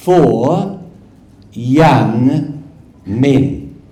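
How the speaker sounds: usual speaking voice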